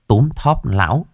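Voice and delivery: ordinary delivery, nothing marked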